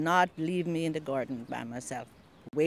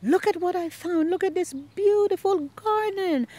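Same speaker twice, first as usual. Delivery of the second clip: excited; 10 semitones higher, 5 dB louder than usual. Retold or imitated. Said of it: "And I was so excited".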